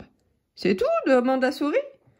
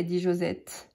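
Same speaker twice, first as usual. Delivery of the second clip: ordinary delivery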